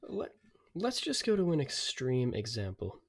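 normal talking voice

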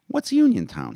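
put-on high young boy asking; little boy voice. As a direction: pitch it up like an eight-year-old. Boy character asks.

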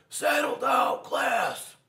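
high, loud teacher shout. Acted. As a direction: yell at the class to calm down.

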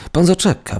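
high urgent call to stop him. A narrator's reading. Clerk calling after the departing petitioner, high urgent call. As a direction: call him back before he leaves. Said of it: "zawołał Sebastianycz".

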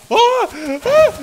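sudden high, loud scream of fright; startled scream. Real, not acted. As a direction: yelp as the dinosaur lunges. Dinosaur attacks him.

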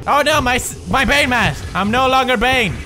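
high, loud hammy lament; mock dismay. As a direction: cry out over a lost prop. Mock dismay at losing mask.

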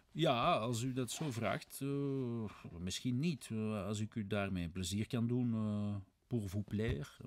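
drawn-out, halting concession; hesitant, reluctant. Acted. Svidrigailov obliging his questioner about ghosts, hesitant and reluctant. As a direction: stall on the filler sounds, stretching the words.

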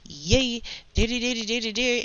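snarky mimicry. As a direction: imitate a snarky young coworker rattling off complaints. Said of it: "she's just the snarkiest attitude".

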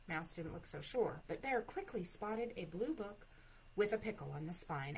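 ordinary delivery, nothing marked

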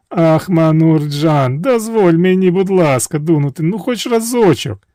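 high, slow wheedling plea; high sweet begging princess; begging sweetly. A narrator's reading. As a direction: coax him for just one go. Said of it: "так вона просила його".

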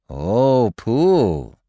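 loud fond exclamation; gushing with delight. Acted. Everybody delighted.

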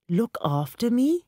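indignant question, pitch leaping up at the end; voice breaking with anger. A narrator's reading. Old Varma indignant at being told he is looked after, angry. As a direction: cry it out in hurt disbelief. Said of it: "his voice breaking with anger".

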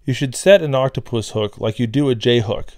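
normal talking voice

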